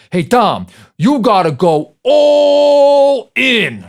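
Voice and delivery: high, loud, drawn-out New York Italian yell, deliberately bad; New York Italian accent. Kid coach yelling at Tom to jump, hammy New York Italian accent. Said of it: "my poor attempt at a New York Italian accent".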